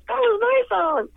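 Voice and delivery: high, loud indignant cry; indignant protest. Retold or imitated. Singer complaining that her song went to Michael, indignant and high. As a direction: protest it like you were robbed.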